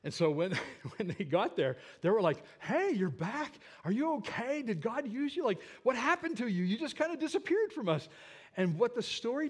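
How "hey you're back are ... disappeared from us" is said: high-pitched, puzzled concern; excited voice jumping well up in pitch; astonished greeting. Retold or imitated. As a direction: imitate surprised friends firing questions. Friends greet him in retold story.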